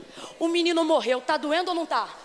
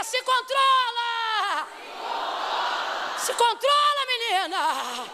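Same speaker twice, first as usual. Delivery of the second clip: much higher, slow shouted order; shouted command; 7 semitones higher, much slower than usual. Shown as a demonstration. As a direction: shout it at her to pull herself together. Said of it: "Olhe de novo e diga". (grito firme e lento: se controla)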